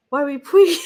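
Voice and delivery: high, loud put-on dog voice. Host voicing her dog's baby-talk question, high and loud. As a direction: ask it like a goofy talking dog.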